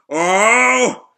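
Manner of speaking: long, loud, high roar of effort; battle yell. Acted. Shiki charging a gravity punch, long battle yell. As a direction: holler while winding up a punch.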